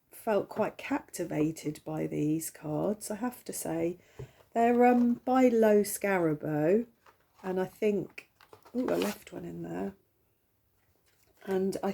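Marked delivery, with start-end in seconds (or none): none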